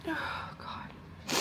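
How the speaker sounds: breathy whisper, exhausted; real weary whisper; whispered oh God. Real, not acted. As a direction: let it out under your breath. Overwhelmed sigh.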